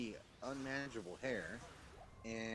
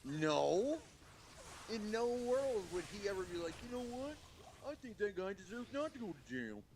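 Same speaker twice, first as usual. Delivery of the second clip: mocking voice; 12 semitones higher than usual. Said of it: "I'm like".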